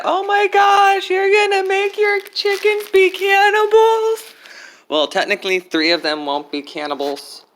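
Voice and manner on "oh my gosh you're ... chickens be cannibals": high, slow mocking friend voice; squeaky shocked imitation of a friend; imitating Anne. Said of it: "I already had to hear it from Anne when she said that".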